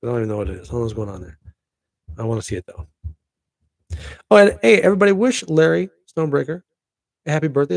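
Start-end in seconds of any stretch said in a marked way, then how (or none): 4.3-7.7 much higher, louder hey to everybody; rally the viewers with a shout; loud call-out. Real, not acted. Asking viewers to wish Larry happy birthday, loud.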